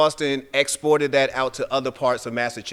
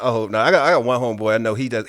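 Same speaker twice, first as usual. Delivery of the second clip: normal talking voice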